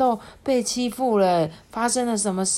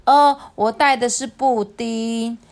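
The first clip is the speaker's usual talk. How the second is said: higher, louder, drawn-out delight; happy reply; 6 semitones higher, 6 dB louder, slower than usual. Acted. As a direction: answer gleefully, stretching the words. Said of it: "我开心地回答说".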